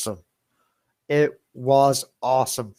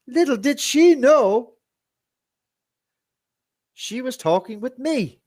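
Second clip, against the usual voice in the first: gleeful gloat; 12 semitones higher, 4 dB louder than usual. Real, not acted. Gloating over prank.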